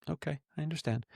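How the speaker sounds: quietly giving in; sheepish. Retold or imitated. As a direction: imitate a meek, chastened reply.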